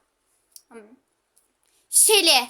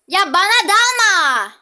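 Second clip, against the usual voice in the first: loud, slow, high desperate plea; pleading shout; 7 semitones higher, 11 dB louder, slower than usual. Real, not acted. Player yelling at enemy Kit not to attack, pleading shout. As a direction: beg at the top of your voice.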